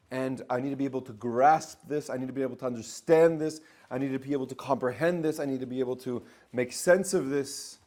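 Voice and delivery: insistent physical world. Acted. The physical world demanding to understand, loud and insistent. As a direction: insist you must grasp it. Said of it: "The physical says".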